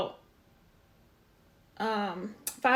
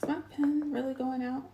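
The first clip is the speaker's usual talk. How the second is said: whispered aside to yourself; whispering; 2 semitones higher, 5 dB quieter than usual. Real, not acted. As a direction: breathe it out under your breath. Pen stops working mid-drawing.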